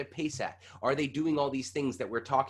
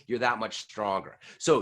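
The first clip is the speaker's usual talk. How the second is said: ordinary delivery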